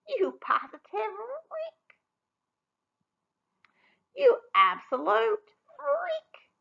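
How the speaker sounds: high, slow sneering taunt; high mocking sneer. Sisters jeering at stinky Rella, high slow sneer. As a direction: drag out the insult high.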